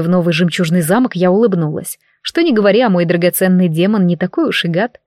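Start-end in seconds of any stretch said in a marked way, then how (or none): none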